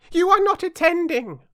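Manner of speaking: high, loud mouse scolding; severe. A narrator's reading. Mouse to inattentive Alice, severely. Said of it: "said the mouse to Alice severely".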